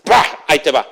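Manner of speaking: loud, high, emphatic sermon delivery; full-force pulpit preaching; fiery preaching. Acted. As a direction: preach it out to the whole congregation.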